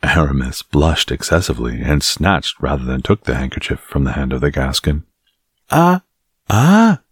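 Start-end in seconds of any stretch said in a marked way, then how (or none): 5.7-7.0 high, teasing exclamation; crying out. A narrator's reading. A guard pounces on Aramis's secret, crying out high. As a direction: pitch it up in a knowing cry. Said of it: "cried one of the guards".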